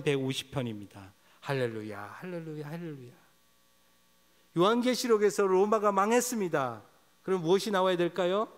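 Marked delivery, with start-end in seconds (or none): 4.6-8.5 proclaiming. Real, not acted. Preacher proclaiming climax.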